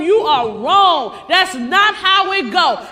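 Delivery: loud, slow, firm "You are wrong"; firm retold rebuke. Daughter telling the teacher he's wrong, loud and slow. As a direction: lay it down hard and slow.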